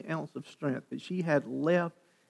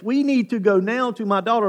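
loud, higher urgent plea; tense, intense urging; 7 semitones higher, 9 dB louder than usual. Acted. Father begging Jesus to come to his daughter, intense. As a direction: push it out with rising urgency. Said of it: "I imagine the tension was pretty intense".

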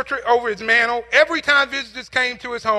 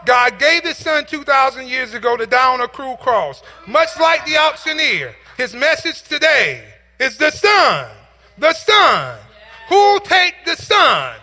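preacher driving home the message about the son, loud and higher; preaching at full force; 4 semitones higher, 3 dB louder than usual. Acted. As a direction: build it to a shouted altar call. Preacher builds to climax.

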